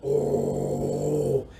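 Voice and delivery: very low, rumbling bear Oh; deep bear sound. Shown as a demonstration. Teacher leading kids in face yoga, low bear sound. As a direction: growl it out like a big bear. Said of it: "make a bear sound".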